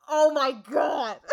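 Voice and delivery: slow, drawn-out, stunned oh my God; stunned reaction. Retold or imitated. Retelling her reaction to the scene, slow and stunned. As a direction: let it land like a gut punch. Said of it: "And I went".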